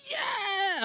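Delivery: retold victory whoop from a teammate; mock teammate yell. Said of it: "three people in your ear going".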